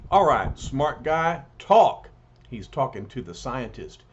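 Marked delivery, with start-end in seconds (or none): 0.1-2.1 a rising, louder demand ending in a barked "talk"; angry demand. Acted. Ben confronting the fake Thing, barking an order. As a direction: press him hard, then bark the last word.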